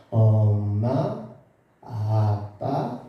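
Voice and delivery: each word stretched very slowly; slow drawn-out speech. Retold or imitated. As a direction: drag the words out like a struggling child. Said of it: "이러면서 말을 느려서 말하기 시작해요". (아주 느리게 늘어지는 말투)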